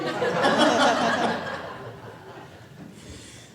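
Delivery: airy laugh at the crowd; breathy laugh. Real, not acted. Preacher laughing at congregation's cheer, airy laugh. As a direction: laugh along with them.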